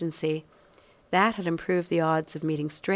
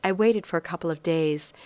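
usual speaking voice

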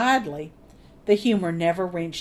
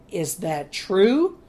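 growling judge; slower than usual. Acted. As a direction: growl it slowly at him. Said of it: "Judge Gardner growled at Winslow".

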